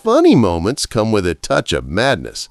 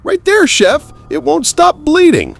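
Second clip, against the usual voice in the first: panicked; 4 dB louder than usual. A narrator's reading. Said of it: "looking genuinely panicked".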